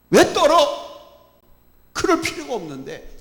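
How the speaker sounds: Jesus rebuking, voice climbing to a shout; rebuke. Acted. Jesus scolds the disciples in the storm, shouting. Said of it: "책망을 하시는 겁니다".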